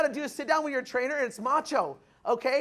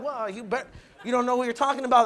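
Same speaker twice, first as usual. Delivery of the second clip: mock indignant retort. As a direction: fire back fast like a defensive friend. Marquise swelling up angrily.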